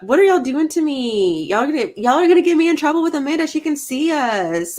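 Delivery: mock exasperation. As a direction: playfully blame them, pitch climbing. Playfully blames viewers for trouble.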